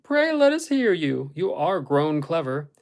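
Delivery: loud, slow, exaggerated praise; teasing encouragement. A narrator's reading. Catherine urges Hareton to show his reading, teasing praise. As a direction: draw it out high and loud, as if humoring him.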